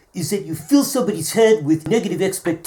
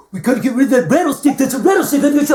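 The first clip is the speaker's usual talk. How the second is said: mock alarm; 6 semitones higher, 4 dB louder, faster than usual.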